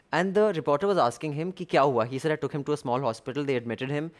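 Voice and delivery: ordinary talk, nothing marked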